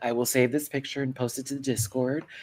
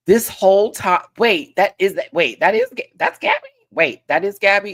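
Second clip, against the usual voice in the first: loud and higher, repeating in disbelief; can't believe who it is, louder each time; stunned realisation; 7 semitones higher, 7 dB louder than usual. Real, not acted. Realises the picture is Gabby.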